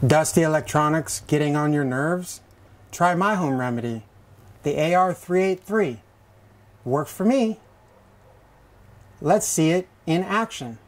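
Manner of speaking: hushed, low-pitched ad pitch; infomercial voice. Acted. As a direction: sell it like a late-night ad.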